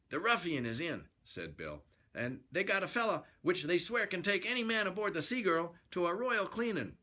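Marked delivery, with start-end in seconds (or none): 0.1-1.1 slightly raised character voice; a hint of an eager shipmate; lightly voiced sailor pal. Narrator voices Bill's reported speech. 2.1-7.0 higher-pitched character voice; high-voiced sidekick talking up a fight; sailor pal. Narrator voices Bill's reported speech.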